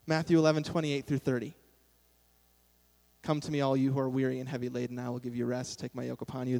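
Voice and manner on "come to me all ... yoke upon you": quick, softer monotone verse recital; rattled-off recitation. Retold or imitated. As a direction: skim through the verse quickly and quietly.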